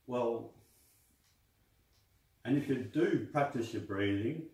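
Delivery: normal talking voice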